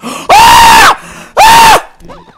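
piercing high shriek; full excited screaming; gasping shriek of delight; real scream. Real, not acted. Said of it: "I'm screaming".

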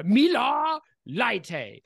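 loud, high, long-held name; booming ring-announcer stretch on the name; winner announcement. Acted. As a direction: proclaim the champion to the crowd. Official proclaims winner's name to crowd.

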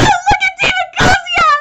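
high, loud fan scream; ecstatic shout. Real, not acted. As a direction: yell for everyone to look, thrilled. Ecstatic at demon mode reveal.

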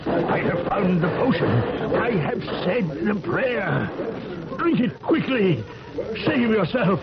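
high, urgent command to drink; desperate father, pitched high and pressing; urgent pleading. Acted. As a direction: press her to drink before it is too late. Father urges daughter to drink elixir.

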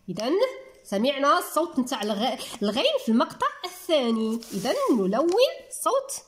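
usual speaking voice

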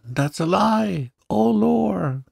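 very high, drawn-out parrot squawk; crying parrot. A narrator's reading. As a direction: screech it slowly like a parrot. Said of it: "cried the parrot".

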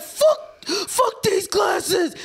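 high, slow, furious shout; angry outburst. Retold or imitated. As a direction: spit it out in rage. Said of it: "He's like".

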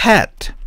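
"hat" pitched high, leaning on the latter part of the vowel; stressed vowel glide. Shown as a demonstration. Teacher models the stress for learners, high emphatic "hat". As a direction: punch the back half of the ae in "hat". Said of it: "뒤에 강세가 있어요".

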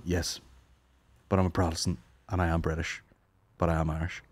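low, soft imitated interviewee; understated answer. Interviewee answering whether he is Irish, low quiet caveats.

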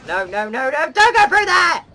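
loud high panicked no no no; panicked yell. Real, not acted. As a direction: shout a frantic warning. Panicked warning in game.

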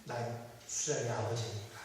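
low, soft and slow; quiet resolve. Retold or imitated. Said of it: "아버지 손현주 씨가 뭐라고 말합니까". (조용하고 단호한 아버지 목소리)

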